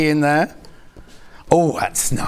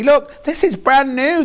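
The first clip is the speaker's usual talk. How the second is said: excited reveal; 11 semitones higher, 4 dB louder, slower than usual. Real, not acted. Excited reveal of new fabric.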